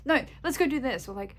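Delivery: urging friends on holiday to get moving, impatient; frustrated urging. Retold or imitated. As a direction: hurry them along, fed up. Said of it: "I get really frustrated".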